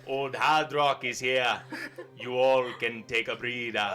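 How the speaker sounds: lightly put-on Jamaican dwarf voice; loud, hammy attempt at a Jamaican accent. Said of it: "He has a Jamaican accent".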